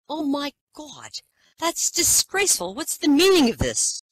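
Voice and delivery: high, loud, scandalised royal gasp; shocked queen. Acted. Queen Elizabeth catching a patient in the act, high and loud. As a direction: clutch your pearls as a scandalised queen.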